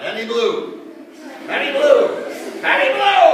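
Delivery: escalating theatrical puff; building blowing effort. Acted. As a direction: huff up to a huge last blow. Storyteller acts out blowing, escalating.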